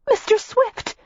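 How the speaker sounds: put-on young woman alarmed when the goose strikes his face; alarmed concern. A narrator's reading. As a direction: call out in fright for him. Goose jabs his eye, she cries.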